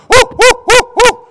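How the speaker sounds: preacher mocks celebrating chores with loud whoops; mock celebration whoops. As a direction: whoop like a touchdown over the dishes. Mock celebration over dishwasher.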